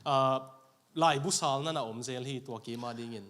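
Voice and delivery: usual speaking voice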